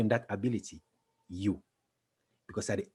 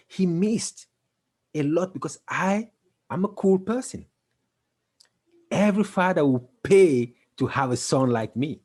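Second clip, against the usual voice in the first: excited boast; 6 semitones higher, 6 dB louder than usual. Real, not acted. Boasting about what father missed.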